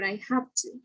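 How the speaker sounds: "have to" as a soft, joined "haf to"; no voiced V. Shown as a demonstration. Teacher ending an example sentence, "have to" with no voicing. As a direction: end "have" on a voiceless f, softly. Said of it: "there's no voiced".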